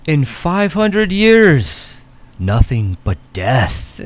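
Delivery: very slow, grave pronouncement; solemn prophet. Acted. A prophet warns the players of death, solemn and slow. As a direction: toll each word like doom.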